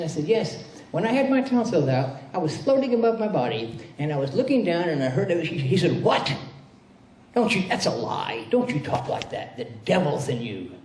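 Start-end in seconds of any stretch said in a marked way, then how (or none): 0.2-5.5 put-on higher, eager boyish voice; a small boy eagerly telling his story; young [name], higher and eager; child voice. 6.0-10.7 angry minister. Retold or imitated. Minister angrily rebukes child.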